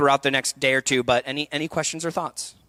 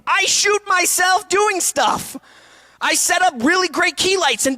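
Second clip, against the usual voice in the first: high, slow, drawn-out shout; loud, high-pitched exclamation; emphatic shout; 13 semitones higher, 4 dB louder, slower than usual. Real, not acted. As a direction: shout it out, stretching each word. Emphatic answer to own question.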